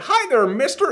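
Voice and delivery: put-on very high little-boy greeting; tiny delivery boy. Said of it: "every time he's just like".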